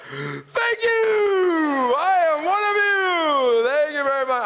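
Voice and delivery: loud, high, drawn-out crowing; hammed-up infant making a grand announcement; baby addressing the crowd. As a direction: cry out slowly to the whole congregation. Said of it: "she just looks at the crowd".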